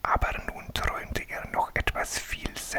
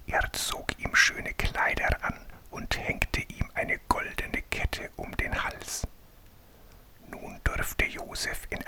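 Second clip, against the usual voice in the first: whispered storytelling; 4 semitones higher than usual. A narrator's reading. Reading a bedtime Bible story to a sleepy child, whispered. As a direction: breathe the story out like a bedtime tale.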